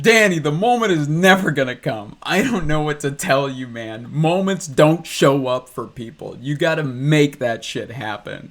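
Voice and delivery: loud, pushy, fired-up lecture; mock yell at him to just act; retold pep talk shout.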